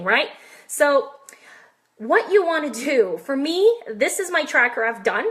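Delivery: normal talking voice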